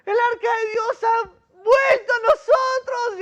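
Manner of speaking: high, loud cry of disbelief and joy; joyful shouting. Retold or imitated. Workers shouting to each other that God's ark has returned, loud and high. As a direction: yell it to the whole field, overjoyed.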